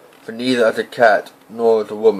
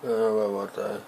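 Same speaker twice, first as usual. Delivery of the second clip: very soft, off-mic aside; quiet aside; 10 dB quieter than usual. Real, not acted. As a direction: mumble it away from the mic. Aside after break.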